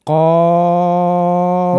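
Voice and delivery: কফ held long, tongue root on the palate; long qaf demo. Shown as a demonstration. Teacher holds কফ for learners to copy. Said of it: "জিভের গোড়াটা ওপরের তালুর সঙ্গে লাগিয়ে দিন".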